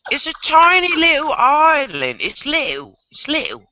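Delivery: high, playful British put-on; mock British accent. Joking about a tiny island, mock British accent. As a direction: swallow the middle of LITTLE, British style.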